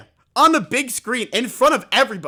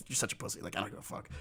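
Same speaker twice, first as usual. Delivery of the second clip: whispered, much softer taunt; whispered jab at the friend; 10 semitones lower, 17 dB quieter, much faster than usual. Retold or imitated. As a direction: hiss it under your breath. Teasing MrBeast.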